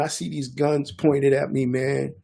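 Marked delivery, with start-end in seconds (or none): none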